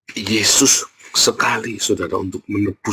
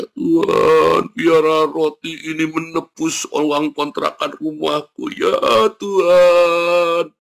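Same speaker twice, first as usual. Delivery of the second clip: mock plaintive prayer; 5 semitones higher, much slower than usual. Preacher mocking a tearful prayer to God, plaintive wail. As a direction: parody a pastor's weepy, lilting plea. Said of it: "berdoanya mendayu-dayu sampai kamu mehek-mehek".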